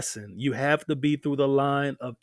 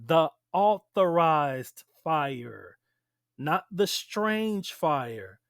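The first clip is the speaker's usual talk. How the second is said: slow, deliberate teaching emphasis; slower than usual. Real, not acted. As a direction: spell out the distinction, drawing each word out. Hammering home which fire is allowed.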